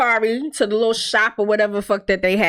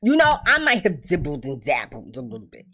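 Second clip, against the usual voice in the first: ordinary delivery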